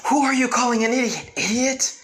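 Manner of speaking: high, loud indignant shout; angry yell. Retold or imitated. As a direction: snap back at him furiously. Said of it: "he got a bit angry".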